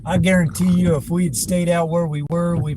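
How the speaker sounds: loud and high, insistent; emphatic loud. Real, not acted. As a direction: drive the point home loudly. Emphatic claim.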